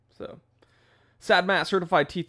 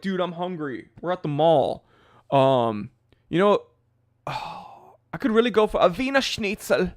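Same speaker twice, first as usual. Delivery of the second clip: higher, louder German-tinged bro voice; mock German bro; 4 semitones higher, 5 dB louder than usual. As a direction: savour the German food name. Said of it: "you're like".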